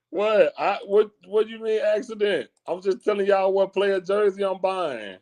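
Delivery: high-pitched, incredulous protest; indignant protest. Real, not acted. Protesting chat's reaction to his purchase.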